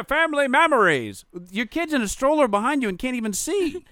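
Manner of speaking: high-pitched mock gush turning to rant; mocking exasperation. Storyteller mocks parents filming over their kids, exasperated.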